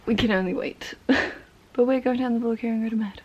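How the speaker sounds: ordinary talk, nothing marked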